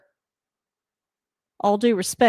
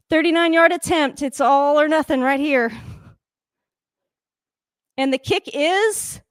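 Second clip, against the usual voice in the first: tense anticipation; 5 semitones higher than usual. Real, not acted. Tense kick moment.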